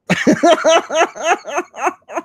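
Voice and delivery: loud high cackle of laughter; high laugh breaking out; high burst of laughter. Real, not acted. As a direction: crack up at your own joke. Big laugh after punchline.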